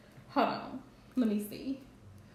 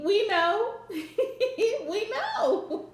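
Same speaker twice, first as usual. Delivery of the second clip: high laughing "we know"; real amused laughter; 9 semitones higher, much slower than usual. Real, not acted. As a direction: laugh through the words. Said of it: "Emily, I'm laughing".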